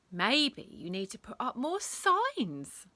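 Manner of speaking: cheeky mock-helpfulness; smirking suggestion. A narrator's reading. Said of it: "suggested Rebel with a smirk".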